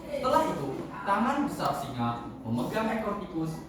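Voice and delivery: plain reading voice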